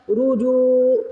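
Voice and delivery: رجو with a heavy ra and a long jeem. Shown as a demonstration. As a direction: say رجو slowly, full ra, stretched جو. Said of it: "را کو موٹا پڑھنا ہے". (رجو میں را موٹی اور جیم کھینچ کر)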